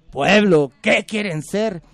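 loud oratory, question drawn out; arenga al pueblo. Acted. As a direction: address the whole plaza, slow and booming. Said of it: "les demuestra el poder, dice". (arenga fuerte, con la pregunta alargada)

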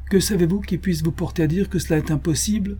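rising in pitch, almost angry; near-angry challenge. Acted. Baronet challenging the doctor, almost angry. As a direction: press him hard, half in anger. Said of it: "presque avec colère".

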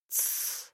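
whispered shush; a soft hushing sound. Acted. He hushes her. (тихое «тсс»)

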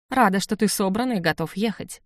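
plain reading voice

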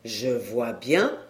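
put-on low, slow old dealer's voice; nasal, smiling old man. A narrator's reading. Old dealer humouring the customer's wife, smiling and nasal. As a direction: draw it out low with a slight nasal smile. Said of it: "souriant et nasillant dans sa barbe grise".

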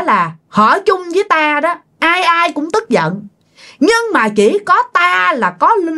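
put-on high, softened but still spiteful old man's voice; spiteful old spirit. Spirit bargaining with the family for offerings, softened but spiteful. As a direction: calmer now, yet resentful, the spirit lays down terms. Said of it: "giọng của ổng tuy là đã nhẹ xuống rồi mà nó vẫn hằn học".

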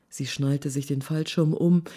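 ordinary delivery, nothing marked

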